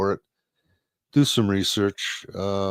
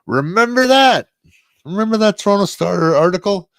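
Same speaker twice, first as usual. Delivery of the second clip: loud outburst; 10 semitones higher, 8 dB louder than usual. Real, not acted. Sudden outburst after a laugh.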